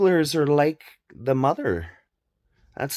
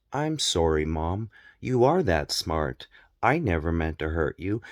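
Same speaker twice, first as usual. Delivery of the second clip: softer, lower, apologetic son; acting the son; 8 semitones lower, 5 dB quieter than usual. Son apologizing to his mother, soft and low. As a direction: back down meekly to mom. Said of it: "that little skit".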